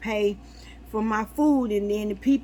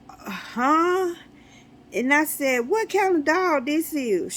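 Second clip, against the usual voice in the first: surprised; 7 semitones higher than usual. Retold or imitated. Shocked question to caller.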